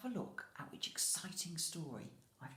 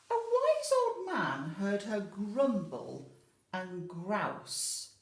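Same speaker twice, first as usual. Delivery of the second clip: louder, slow, weighty reading; storybook narration; 4 semitones higher, 9 dB louder, slower than usual. A narrator's reading. Teacher reading aloud to children, emphatic storytelling. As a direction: lean into each word for the children.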